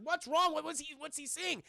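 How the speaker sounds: high, urgent questioning; acted alarm. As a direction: ask like a mock-panicked rescuer. Retold rescuers' alarm at the screaming.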